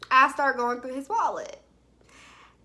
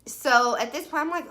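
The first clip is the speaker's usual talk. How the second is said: ordinary delivery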